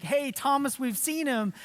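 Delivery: excited disciples. Retold or imitated. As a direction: break the news like excited friends. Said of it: "they say to Thomas".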